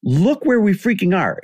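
high-pitched exasperated outburst at friends; indignant retelling, voice pushed high; retold anger. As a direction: act out being angry at your buddies, pitch jumping up. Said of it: "physically angry at them".

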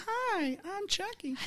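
high soft toy voice; sweet chirpy doll greeting; talking doll. Retold or imitated. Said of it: "this doll voice".

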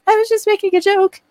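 high, lightly voiced little girl; a mild put-on child's voice; imitating child. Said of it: "And she was like".

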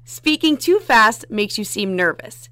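slow, projected read, starting loud; careful contest reading. Shown as a demonstration. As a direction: read it to win, loud and deliberate. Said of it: "You got one shot".

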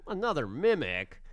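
slow, high, drawn-out groan of mock disbelief; mock disappointed reaction. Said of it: "well, at first I was like".

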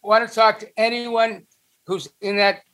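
ordinary delivery

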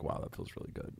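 hushed, matter-of-fact remark, barely above a breath; quiet normal voice. Shown as a demonstration. Telling co-host how to praise in bed, quiet normal voice. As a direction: say it very softly, almost whispered, in a plain sentence. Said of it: "In my normal voice".

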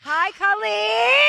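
slow, high sing-song hello; drawn-out greeting. Acted. Greeting a guest on the podcast, slow sing-song. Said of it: "say hi to Colleen Siragusa".

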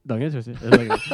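giggling through the catchphrase; can't hold back a laugh. Acted. Catchphrase repeated while laughing.

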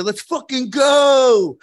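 slow, high and loud gym-bro cheer; drawn-out bro yell, high and loud; gym bro hype. Retold or imitated. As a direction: pump up the whole locker room. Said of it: "is that the tone of your voice?".

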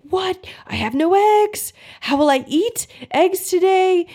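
high-pitched mock panic; freaking out. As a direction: squeal it like a brain in sudden panic. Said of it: "And maybe your brain starts freaking out".

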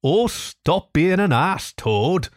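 mildly despairing, drawn-out appeal; despairing cry. A narrator's reading. Mole begging Toad to stop, mildly despairing. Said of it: "cried the mole despairingly".

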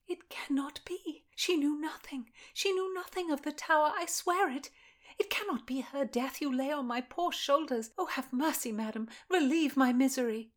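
put-on a young lady in service, desperate and pleading; frightened young lady pleading. Constance voiced again.

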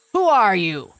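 loud demanding roar of a question; lion yelling. A narrator's reading. Lion yells at the boy crawling past, loud and demanding. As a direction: bark it out loud at the intruder. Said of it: "the lion yelled at my father".

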